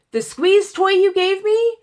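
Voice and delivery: high, loud disbelieving question; incredulous. Retold or imitated. Patient questioning techs about the squeeze toy, retold incredulity. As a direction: throw it back at them in outraged disbelief.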